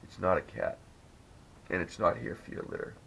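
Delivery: low hushed horror-movie warning; mock-ominous whisper like a ghost hunter; spooky movie quote. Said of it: "the dork from The Conjuring goes".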